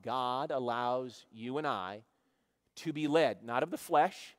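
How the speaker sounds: ordinary talk, nothing marked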